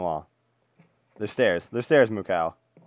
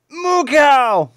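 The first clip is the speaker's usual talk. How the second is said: high, loud cry of discovery; excited shout; 10 dB louder than usual. Real, not acted. Player spotting a cow in the game, excited shout. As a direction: yell it out, stretched and way up high.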